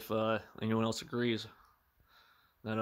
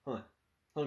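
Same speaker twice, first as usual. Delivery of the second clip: usual speaking voice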